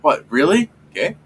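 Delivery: short, high rising questions; mock surprise. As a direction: imitate a puzzled double take, pitch jumping. Retold surprise, then acceptance.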